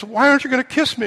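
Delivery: a hint of a pouting princess; indignant frog princess. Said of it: "the frog says".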